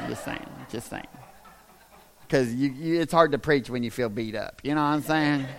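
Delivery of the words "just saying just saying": low, very soft throwaway; muted aside. Real, not acted. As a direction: drop it under your breath, much lower. Sheepish aside after jab at mama.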